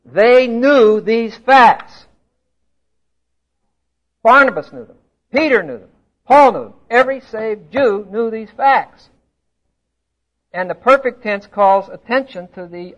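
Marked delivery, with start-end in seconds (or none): 0.0-2.1 slow, louder, each word hammered, "knew" held long; emphatic knew. Real, not acted. Preacher hammering home the point. 4.2-9.1 emphatic listing. Real, not acted. Listing who knew, emphatically.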